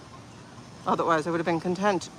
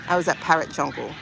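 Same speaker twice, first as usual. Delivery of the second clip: ordinary delivery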